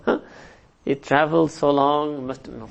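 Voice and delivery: ordinary talk, nothing marked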